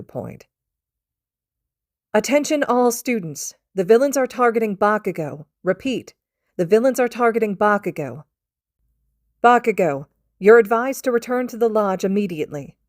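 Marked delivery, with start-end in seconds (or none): 2.1-12.8 higher and louder, an urgent camp-wide warning; urgent announcement. Acted. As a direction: call it out to all students like an alarm.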